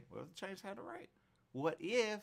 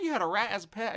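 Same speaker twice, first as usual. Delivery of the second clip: surprised disbelief; 8 semitones higher, 7 dB louder than usual. Retold or imitated. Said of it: "A lot of people go".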